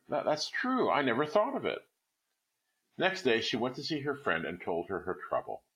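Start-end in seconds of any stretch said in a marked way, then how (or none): 0.1-1.8 higher, delighted wife; sudden joy at the idea; cry of delight. A narrator's reading. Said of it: "She uttered a cry of delight".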